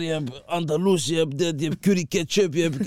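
high, loud put-on accent; Moroccan accent. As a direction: imitate the proud uncle. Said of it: "Hij zegt dan".

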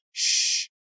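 sharp voiceless shush; loud hush on breath alone. A narrator's reading. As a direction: shush them urgently. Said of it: "held a finger to her lip".

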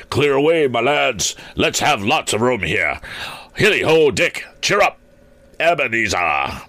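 high, hearty calling out; rally the lads with ringing good cheer; jovial boss. A narrator's reading. Fezziwig calls merrily to his apprentices.

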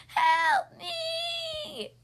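high, long cry for help. A narrator's reading. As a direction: wail it out high as a storybook duck in peril. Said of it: "cried Duck".

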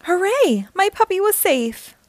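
high, bright cheer of relief; joyful cheer. A narrator's reading. Puppy rescued, relief.

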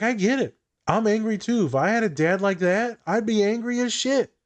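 a hint of Jess, up high, getting worked up; imitating Jess from New Girl. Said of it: "she's like".

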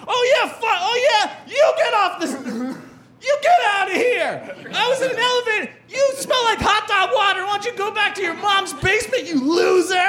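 high, shouting taunt with laughs breaking through; mocking comeback. Comic to the elevator heckler, high mocking shout. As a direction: roast him right back.

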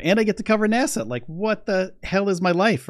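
giddy excitement. Real, not acted. Telling viewers he gets to cover NASA, giddy. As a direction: let the joy spill over. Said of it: "I'm like a little kid, you know, I'm super happy".

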